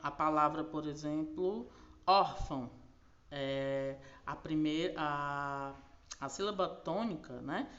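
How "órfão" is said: "órfão" said loudly, leaning on the first syllable "ór"; stress on ÓR. Shown as a demonstration. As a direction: hit the "ór" of "órfão", let "fão" drop. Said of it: "a penúltima". ("órfão" com a primeira sílaba forte)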